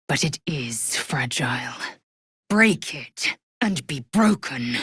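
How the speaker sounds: hushed warning flaring loud; warning building to threat. Acted. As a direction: whisper rising to a loud threat. Whispered warning then loud threat.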